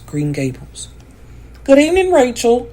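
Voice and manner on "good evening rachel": high, louder brisk greeting; a lightly voiced brisk Marilla. A narrator's reading. Marilla greets her neighbour Rachel, brisk and high. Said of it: "Marilla said briskly".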